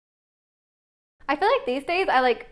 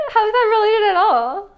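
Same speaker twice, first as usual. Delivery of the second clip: disbelief; 9 semitones higher, 9 dB louder than usual. Real, not acted. Baffled by the word Mancunian.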